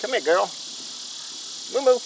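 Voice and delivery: calling the pet. Real, not acted.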